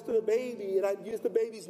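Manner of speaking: lightly demonstrated talking-to-the-belly voice; talking to unborn baby. Shown as a demonstration. Dad showing how he talked to his unborn baby, lightly put-on voice. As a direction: show how you talked to the baby in the womb. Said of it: "I'd talk like this".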